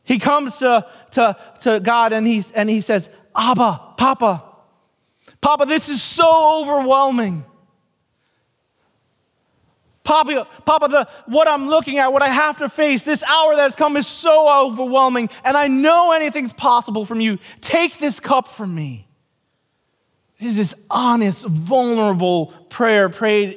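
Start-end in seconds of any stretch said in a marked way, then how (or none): 3.4-4.4 anguished plea. Acted. Jesus voiced praying in anguish. 5.4-7.4 anguished cry. Acted. Jesus crying out to God. 10.0-19.0 anguished plea. Acted. Jesus voiced praying in anguish.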